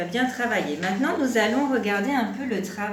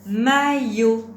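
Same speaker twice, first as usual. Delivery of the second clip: loud, deliberate teacher's model word; syllables held evenly and loudly; word dictation; 2 semitones higher, 7 dB louder than usual. Shown as a demonstration. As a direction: project each syllable clearly for a learner.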